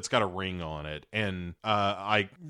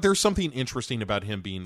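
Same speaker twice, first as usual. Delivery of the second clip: ordinary delivery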